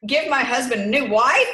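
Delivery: loud, rising incredulous question; can't believe what she just read; shocked disbelief. Real, not acted. Shocked misreading in prayer.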